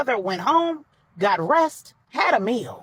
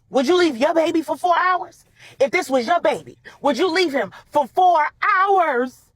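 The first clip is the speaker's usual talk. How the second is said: loud, high, insistent questioning; indignant challenge; 6 semitones higher, 5 dB louder than usual. A narrator's reading. Mother challenging Maribel about leaving a baby, indignant. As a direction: throw the question at her, pitched up and loud.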